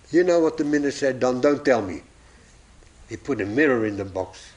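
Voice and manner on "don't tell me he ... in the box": ordinary talk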